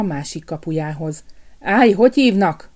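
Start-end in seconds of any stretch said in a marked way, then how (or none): 1.6-2.7 louder, gruff guard's growl; angry gatekeeper's growl; growled challenge. A narrator's reading. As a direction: growl it at the intruder. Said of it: "mordult rá a kapus".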